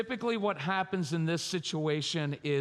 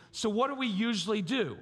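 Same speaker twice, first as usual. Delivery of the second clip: ordinary talk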